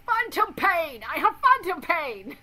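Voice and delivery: high, loud whiny cry of phantom pain; mock melodramatic wail of phantom pain; mocking VR chat people. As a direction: shriek it like an overdramatic gamer. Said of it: "the VR chat people who are like".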